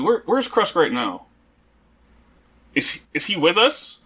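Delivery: ordinary talk, nothing marked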